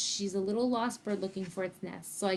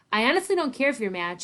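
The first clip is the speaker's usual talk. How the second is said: higher, louder, stung retort; spiteful; 4 semitones higher, 8 dB louder than usual. A narrator's reading. Girl lashing back at her friend, spiteful. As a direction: throw it at them with spite. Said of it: "she says spitefully".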